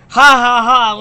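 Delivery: loud manic villain cackle; Joker laugh. Pretend. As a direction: cackle like the Joker mid-sentence. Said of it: "the Joker's laugh coming through Mark Hamill".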